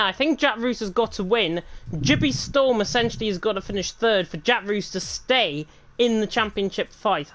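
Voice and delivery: ordinary delivery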